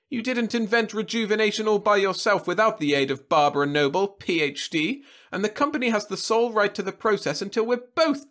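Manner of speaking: put-on high, loud, sharp old lady retort; snapping back. A narrator's reading. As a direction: snap back at him. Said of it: "who snapped back".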